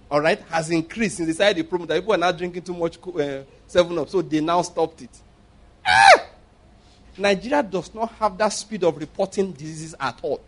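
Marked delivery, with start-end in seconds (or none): 5.9-6.3 sudden very high, loud "Ah!"; sharp high exclamation. Real, not acted. Preacher scoffing at the news report, yelping. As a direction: yelp of mock disbelief.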